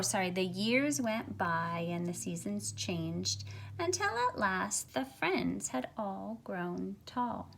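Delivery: ordinary talk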